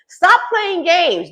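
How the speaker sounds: high, slow, drawn-out scold. Real, not acted. Vlogger scolding Lisa in a high, slow, fed-up tone. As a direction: drag each word out like a fed-up friend.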